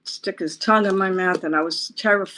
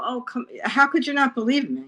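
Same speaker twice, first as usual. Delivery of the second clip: pleading disbelief; 7 semitones higher than usual. Retold or imitated. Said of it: "I go".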